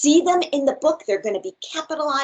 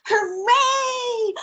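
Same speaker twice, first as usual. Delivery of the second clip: cheering hooray; 12 semitones higher than usual. Acted. Lands on giraffe.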